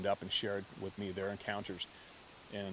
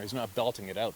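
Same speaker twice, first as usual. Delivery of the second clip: usual speaking voice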